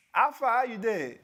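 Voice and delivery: Nigerian accent, high and loud, both words hit hard; Nigerian pidgin accent. Shown as a demonstration. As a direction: show how a Nigerian greets a friend in pidgin. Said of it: "Can you do like this? Do like this".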